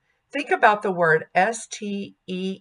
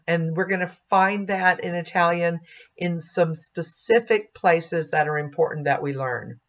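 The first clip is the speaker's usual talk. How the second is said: usual speaking voice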